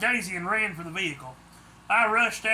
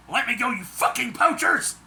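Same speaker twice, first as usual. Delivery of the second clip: higher, louder furious outburst; angry; 6 semitones higher, 6 dB louder than usual. A narrator's reading. Daisy yells at her kidnappers, angry and loud. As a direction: shout at the captors in rage. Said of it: "she told them angrily".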